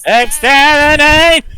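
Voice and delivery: shrill robotic Dalek shout, slow. Acted. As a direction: screech it like a Dalek. Dalek catchphrase.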